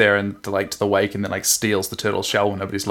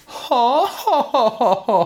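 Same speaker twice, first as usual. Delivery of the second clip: put-on high, haughty rich-girl 'ho ho ho'; Ojo Summer laugh; 13 semitones higher, much slower than usual. Shown as a demonstration. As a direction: put on a princess's affected, drawn-out laugh. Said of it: "what I like to call the Ojo Summer laugh".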